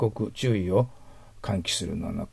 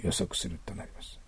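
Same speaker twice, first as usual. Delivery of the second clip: whispered gloss; 3 semitones lower, 10 dB quieter than usual. Shown as a demonstration. Teacher translates the phrase.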